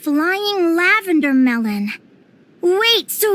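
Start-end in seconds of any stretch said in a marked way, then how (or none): none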